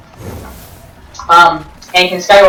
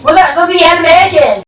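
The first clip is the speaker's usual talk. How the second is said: fast, higher whiny objection; a quick, high, mock-indignant retort; mock commenter; 11 semitones higher, faster than usual. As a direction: imitate a nitpicking viewer complaining. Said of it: "waiting for the comments saying".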